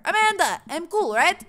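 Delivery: high, loud call to a daughter; yelling across the yard. Acted. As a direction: shout it out to someone far away. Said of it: "I yell across the yard".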